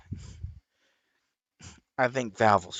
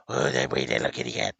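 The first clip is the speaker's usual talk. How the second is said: fast, voiced sing-song coo; faster than usual. Real, not acted. Owner cooing aloud to his cat, sing-song.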